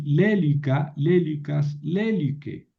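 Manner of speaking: lélica, lélicas, lélique stressed on the first syllable LÉ; antepenult stress. Shown as a demonstration. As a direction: model lélica, lélicas, lélique, punching each opening LÉ. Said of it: "Y la pronunciación hace, es así".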